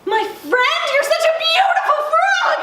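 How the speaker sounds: high gushing princess; overjoyed. A narrator's reading. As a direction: squeal with delight at a new friend. Princess delighted by the frog.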